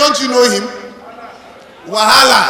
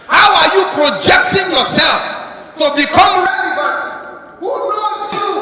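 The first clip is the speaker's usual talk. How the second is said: raised, louder sermon voice; fiery preaching; 5 semitones higher, 3 dB louder than usual. Acted. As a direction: drive it home to the congregation. Preaching at full force.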